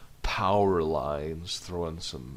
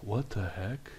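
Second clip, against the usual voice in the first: a softer, puzzled what the heck; incredulous; 2 semitones higher, 4 dB quieter, slower than usual. Shown as a demonstration. Character reacting to something odd, mildly incredulous. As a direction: ask it in mild disbelief, not anger. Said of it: "someone could be incredulous".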